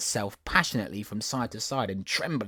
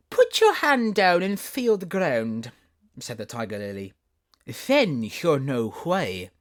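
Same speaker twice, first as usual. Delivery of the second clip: lofty flower; 5 semitones higher, 6 dB louder, slower than usual. Acted. Tiger lily instructs.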